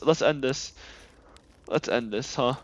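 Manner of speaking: ordinary talk, nothing marked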